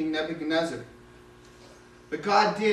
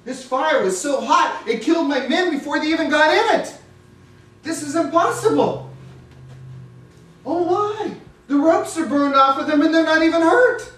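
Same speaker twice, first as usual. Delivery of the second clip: high, loud, growing astonishment; king watching the men walk unhurt, high and loud in wonder; amazed disbelief; 9 semitones higher, 6 dB louder than usual. Acted. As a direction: marvel aloud at the miracle.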